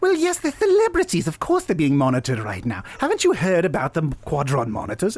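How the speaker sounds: high-pitched start, gushing character voice; very excited Delilah. Acted. Delilah explaining the monitors to the tavern, very excited. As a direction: gush it with eager excitement. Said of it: "Delilah's very excited".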